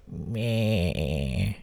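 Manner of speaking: long drawn-out bleating groan; groggy "meh" dragged out, fading. Acted. Groggy date nudged awake on the bathroom floor, groaning. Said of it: "she groaned".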